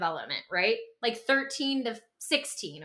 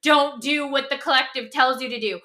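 louder, odd-sounding rebellious cry; mock teen rage; 3 semitones higher, 5 dB louder than usual. Host imitating teens raging at the collective, mock rage. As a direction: spit it like a furious teenager. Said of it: "that rage of".

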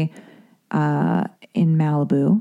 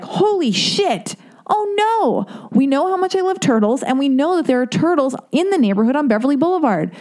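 acted-out alarm; 8 semitones higher than usual. Reading that turtles were found mutilated, dismayed. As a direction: wail it in comic horror.

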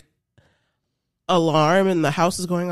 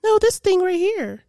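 put-on higher, louder kid insisting; sister insisting; 11 semitones higher, 3 dB louder than usual. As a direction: point at it and insist. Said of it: "My sister's like".

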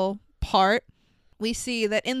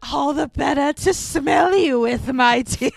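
mock Big Bad Wolf; 3 semitones higher than usual. Joking about Voldemort's nose, hammy wolf voice.

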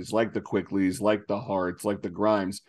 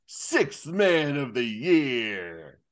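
drawn-out, higher-pitched mock-demonic announcement; very slow, eerie imitation, pitched up; Taj Gibson, demonic; 5 semitones higher, much slower than usual. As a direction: stretch every word out like a gleeful demon. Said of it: "he just gives this demonic look on his face".

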